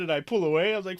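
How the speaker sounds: usual speaking voice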